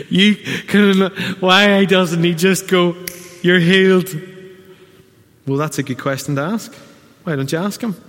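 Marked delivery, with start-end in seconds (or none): 0.1-4.1 higher, louder indignant complaint; louder mock exasperation; mock exasperated. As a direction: demand it like a frustrated child.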